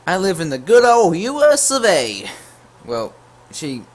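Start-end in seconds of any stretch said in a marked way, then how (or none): 0.1-2.3 high, loud mock girl voice. Imitating the girl on PlayStation in a high, loud mock voice. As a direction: squeak it out like the girl messaging him. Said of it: "She says".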